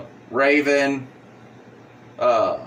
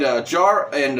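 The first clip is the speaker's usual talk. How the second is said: ordinary talk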